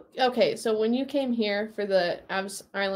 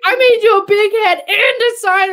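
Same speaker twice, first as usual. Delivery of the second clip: high, loud half-laughing whine; mock indignant complaint; 11 semitones higher, 8 dB louder than usual. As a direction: playfully scold the player who ignored the sign. Retold mock complaint to player.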